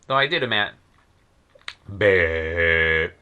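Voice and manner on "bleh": short, disgusted raspberry at his own slip; a louder exasperated bleh; annoyed bleh. Real, not acted. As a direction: spit out a fed-up bleh.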